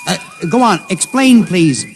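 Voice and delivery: shouting a plea into the radio; urgent pleading. Acted. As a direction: beg him to explain.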